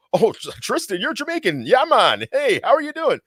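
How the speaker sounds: way up high, gushing "yeah, man" caricature; mock eager greeter. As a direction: parody an overeager American hailing the Jamaican. Americans greeting him as Jamaican.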